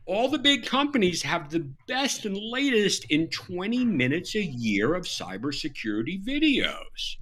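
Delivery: mock-impressed, starting high then settling; sarcastic. Real, not acted. Guest mocking big companies' training to podcast hosts, sarcastic. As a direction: praise it with obvious sarcasm. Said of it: "That wasn't too sarcastic, was it?".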